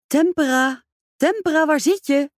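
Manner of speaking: high, loud call up the stairs; calling upstairs. Acted. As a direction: call her name from below, loud and high.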